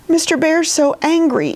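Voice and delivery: high, lightly wailed complaint; mild wail. A narrator's reading. Edith fears Mister Bear's anger, mildly wailing. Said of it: "Edith wailed".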